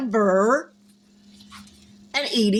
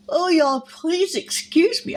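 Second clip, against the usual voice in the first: talking through a yawn; mid-yawn apology; 7 semitones higher than usual. Real, not acted. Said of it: "I keep yawning".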